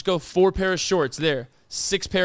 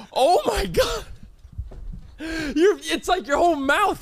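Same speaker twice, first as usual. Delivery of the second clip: laughing; 7 semitones higher than usual. Real, not acted. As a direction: laugh through the words. Cracking up at the lisp.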